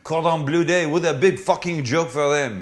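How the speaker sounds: lightly put-on French accent, mocking the Americans; sneering French accent, only a hint of it. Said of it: "That's a good accent".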